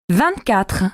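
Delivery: normal talking voice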